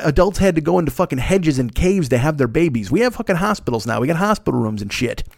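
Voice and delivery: ordinary talk, nothing marked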